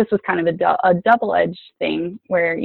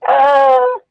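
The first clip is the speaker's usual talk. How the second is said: mock whiny refusal; 7 semitones higher than usual. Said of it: "I was like".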